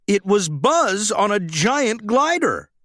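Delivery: usual speaking voice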